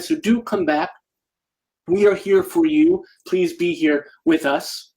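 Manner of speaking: ordinary talk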